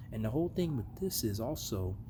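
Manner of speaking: ordinary talk, nothing marked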